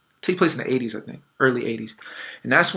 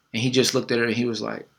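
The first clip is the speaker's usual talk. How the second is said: ordinary talk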